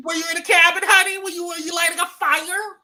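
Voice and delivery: sassy exaggerated echo. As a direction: toss it back with sass. Echoes louder and higher.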